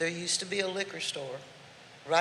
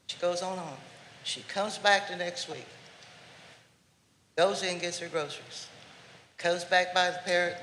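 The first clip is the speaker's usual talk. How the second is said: usual speaking voice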